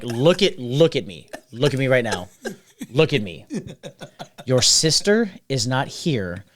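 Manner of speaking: low, deliberate command for attention; firm talking-down. Retold or imitated. Bartender retells firmly calming scared customer.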